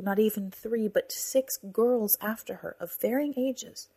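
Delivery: ordinary delivery, nothing marked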